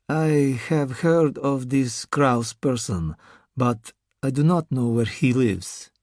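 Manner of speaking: put-on slow, heavy Russian accent; the masked Russian, unhurried. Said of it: "said with an accent".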